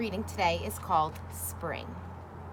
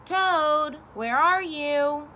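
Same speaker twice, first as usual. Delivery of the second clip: loud, higher, slow call into the dark; 5 semitones higher, 5 dB louder, much slower than usual. A narrator's reading. Frog calling for Toad in the dark room. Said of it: "called Frog".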